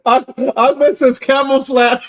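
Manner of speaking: reading it out through real laughter, high and loud; can barely get the words out for laughing; laughing while reading. Real, not acted. Reading funny comment while laughing.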